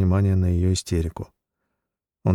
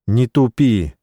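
louder snap; raised voice; 4 semitones higher, 5 dB louder, slower than usual. A narrator's reading. Husband snapping at wife, loud. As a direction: raise the voice sharply. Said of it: "повысил голос Жора".

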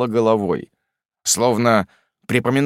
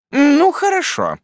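stern aunt; 4 dB louder, slower than usual. A narrator's reading. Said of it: "проговорила мисс Бетси".

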